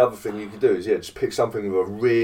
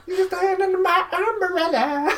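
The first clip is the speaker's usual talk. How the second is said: very high squeaky singing voice; chipmunk voice. Pretend. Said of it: "all squeaky voices".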